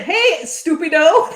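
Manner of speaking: loud, drawn-out mock Spanish insult; imitating Ed. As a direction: call it out slowly and loudly. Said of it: "He said".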